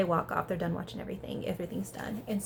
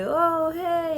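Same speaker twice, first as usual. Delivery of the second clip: high, loud "oh, hey"; a booming, higher-pitched hello; mock boys' greeting; 8 semitones higher, 7 dB louder than usual. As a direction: imitate guys making a big entrance. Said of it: "they're like".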